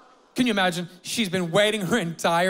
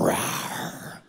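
soft breathy rawr, a little boy playing monster; growling kid; 5 dB quieter than usual. Ring bearer growls at wedding guests, a soft playful monster rawr. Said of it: "He'd walk up to people and go".

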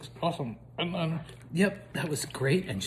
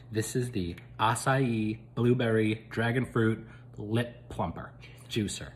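ordinary talk, nothing marked

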